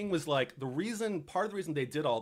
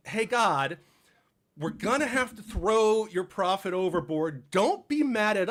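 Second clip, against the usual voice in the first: a louder, pleading call to the heavens; calling out to God; 4 semitones higher, 6 dB louder than usual. Retold or imitated. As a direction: beg the sky not to be angry, voice raised.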